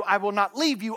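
ordinary talk, nothing marked

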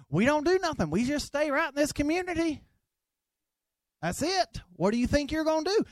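a lightly voiced dismissive local; mocking hometown doubters. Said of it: "I was told I would never make it".

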